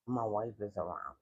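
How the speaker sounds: very low, hushed voice; furtive whispering. Acted. Man on the phone hiding the call from his wife, hushed. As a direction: drop it right down so nobody overhears. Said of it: "And he whispering".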